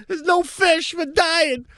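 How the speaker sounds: mock-dramatic cry from a sinking boat; mock movie sailor. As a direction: play a doomed fisherman for laughs. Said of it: "like Cole Clooney in A Perfect Storm".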